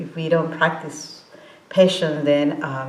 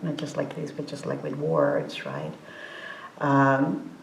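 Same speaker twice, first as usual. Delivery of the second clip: ordinary talk, nothing marked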